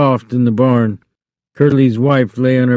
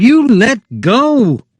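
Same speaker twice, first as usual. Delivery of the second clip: high, loud and slow; wife yelling as he holds her hair; panicked cry; 12 semitones higher, 4 dB louder, slower than usual. A narrator's reading. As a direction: demand he let go. Wife struggling with Lenny.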